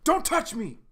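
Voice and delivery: high, loud furious yell; angry shout. Acted. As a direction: snap at him to back off. John lashing out at Doc.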